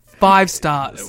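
loud proclamation. Real, not acted. Proclaiming a match rating.

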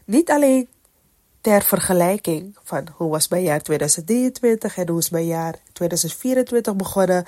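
ordinary delivery

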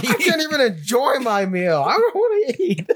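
high, whining comic tantrum; mock despair. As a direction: wail it like a sore loser. Acting the sulking gamer at dinner.

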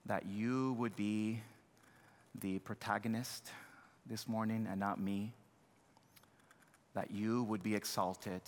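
soft, unhurried pleading prayer with long pauses; earnest prayer. Acted. Pastor prays before the sermon, soft and pleading.

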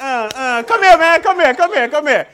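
calling son onto stage. Real, not acted.

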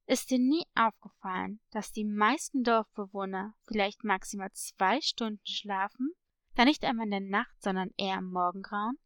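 low-pitched, knowing parrot voice; mysterious parrot. Acted. Parrot revealing a village secret to a girl, low and mysterious. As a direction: question them in a low, leading voice.

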